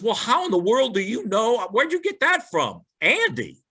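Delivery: high-pitched, lightly acted bafflement; incredulous teacher. Sunday school teacher questions the boy's answer, high mock incredulity.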